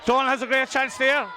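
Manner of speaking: usual speaking voice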